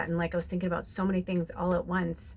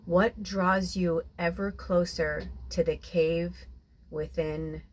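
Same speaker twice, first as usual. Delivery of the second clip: guided meditation. Acted. Guided visualization.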